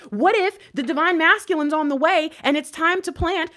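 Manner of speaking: loud, high, urgent pleading; real frustrated outpouring; impassioned outburst. Real, not acted. Impassioned rant at the viewers.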